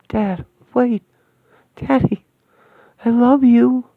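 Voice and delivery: high, loud cry after departing father; tearful call. Acted. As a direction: call out your love before he goes. Daughter calls to leaving father.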